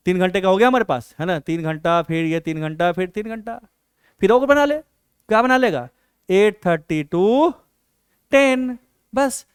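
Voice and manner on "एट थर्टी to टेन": slow, stretched-out time slot; drawn-out dictation. Real, not acted. Teacher writing the evening study slot, slow drawn-out dictation. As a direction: draw out each time as you note it.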